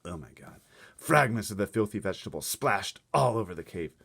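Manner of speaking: usual speaking voice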